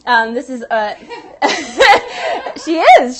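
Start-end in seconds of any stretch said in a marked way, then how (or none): 0.9-3.2 loud, high, laughing insistence. Real, not acted. Vouching for her actress colleague on air, laughing loudly. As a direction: insist through real laughter.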